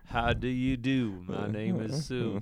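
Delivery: slow, measured mock introduction. Co-host finishing the fan's doorstep story as the star, slow mock-solemn greeting. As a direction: draw the greeting out slowly, like a country legend. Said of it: "this booming voice".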